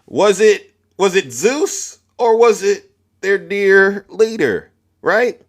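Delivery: slow, high, taunting; mocking question. Real, not acted. Streamer taunting MAGA viewers, slow and high. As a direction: drag it out to mock them.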